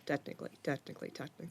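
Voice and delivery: sly whisper, tapering off to nothing; whispered teasing echo; whispered mock repetition. Real, not acted. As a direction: breathe the words like a conspiratorial joke. Mocking a technicality.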